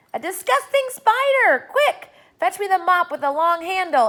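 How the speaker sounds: high, loud disgusted yelling; panicked shout. A narrator's reading. Aunt Sponge calling for the mop, panicked loud shouting.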